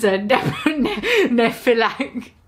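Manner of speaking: laughing through a stumbled word; giggling stumble. Real, not acted. Reader stumbling over a silly word, giggling.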